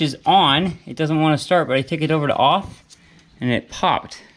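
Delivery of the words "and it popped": normal talking voice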